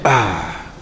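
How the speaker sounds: soft, low ah of wonder; hushed admiration. Retold or imitated. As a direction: sigh it out in quiet awe. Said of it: "You be like".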